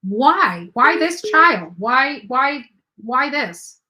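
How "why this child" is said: usual speaking voice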